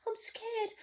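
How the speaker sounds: soft, crying "I'm scared"; tearful scared. Acted. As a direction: let it break into a sob. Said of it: "the cook declared".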